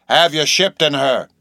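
odd seaman demanding an answer; insistent repeated question. A narrator's reading. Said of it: "he repeated".

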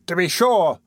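carpenter calling out. A narrator's reading. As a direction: agree with a lifted voice. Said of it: "cried a sleepy-faced carpenter".

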